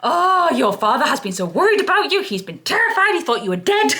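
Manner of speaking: hammed-up Molly Weasley telling off a lost bird; Mrs Weasley scolding. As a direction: scold like a frantic worried mum. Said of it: "I went full her on Lyra".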